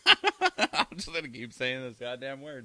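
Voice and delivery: laughing through the words. Real, not acted. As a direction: giggle while you talk. Laughing at own stumbles.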